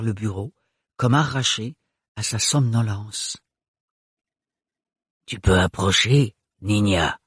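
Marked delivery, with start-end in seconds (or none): 5.3-7.2 put-on low guttural old woman's murmur. A narrator's reading. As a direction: murmur it deep in the throat. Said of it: "murmura la voix gutturale".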